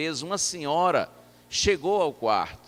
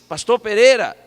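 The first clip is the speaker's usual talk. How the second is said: louder, higher call to wake someone; raised-voice call; 5 semitones higher, 12 dB louder than usual. Retold or imitated. Visitor leaning closer to a near-deaf pastor, raised-voice call. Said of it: "encostei mais e disse".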